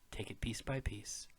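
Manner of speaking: soft whispered encouragement. Real, not acted. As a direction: whisper it gently.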